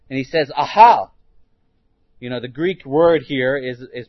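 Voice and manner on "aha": sudden loud exclamation from the demon; loud cry. Acted. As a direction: bark it out in surprise and fear. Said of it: "confronts Jesus in a loud voice".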